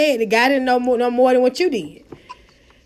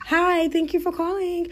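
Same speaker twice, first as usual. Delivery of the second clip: higher, softer call-center greeting; professional phone voice; 5 semitones higher, 5 dB quieter than usual. Shown as a demonstration. Call-center agent answering a customer, professional phone voice. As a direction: put on your polite corporate airs. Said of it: "I put on".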